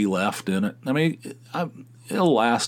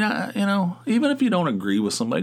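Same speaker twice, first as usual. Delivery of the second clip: normal talking voice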